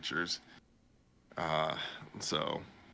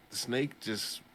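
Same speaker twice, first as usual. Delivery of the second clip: normal talking voice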